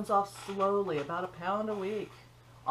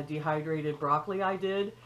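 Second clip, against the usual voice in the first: usual speaking voice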